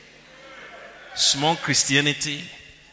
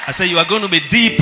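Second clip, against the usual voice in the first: loud preaching shout; 12 dB louder, faster than usual. Acted. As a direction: shout it out, faster. Preacher repeating for emphasis.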